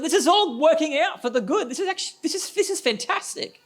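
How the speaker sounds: high, bright laughing burst of joy; joyful Paul. Retold or imitated. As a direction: pastor acting out a joyful Paul to the church, laughing and excited. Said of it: "And he's hopeful".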